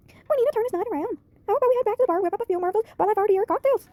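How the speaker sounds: high, bright-toned put-on voice of a friend; Peyton voice. Friend rushes to the police station, high Peyton voice. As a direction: pitch way up to play Peyton fussing over her friend. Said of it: "I like to do her voice".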